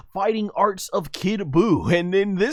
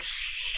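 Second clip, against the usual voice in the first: long hissed "shh" sound effect; whooshing noise; 11 dB quieter than usual. Retold or imitated. Reviewer mimicking a flying cookie, drawn-out whoosh. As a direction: imitate something whizzing through the air.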